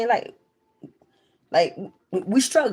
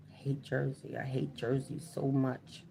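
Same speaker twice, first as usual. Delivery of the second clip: softer, lower, deflated voice; dejected grumble; 5 semitones lower, 13 dB quieter than usual. Retold or imitated. Having to come back home to Jersey, dejected and quiet. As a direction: let it sink out quietly. Her reaction to coming back.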